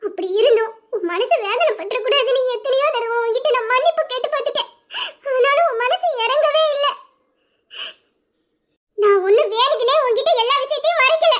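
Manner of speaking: tearful pleading. Acted. Wife crying to her husband after he sends her away. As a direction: sob out how wronged she feels.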